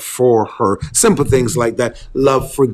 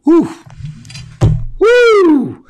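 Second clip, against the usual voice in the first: excited whoop. Real, not acted. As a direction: whoop it up with joy. Preacher exclaims after demo.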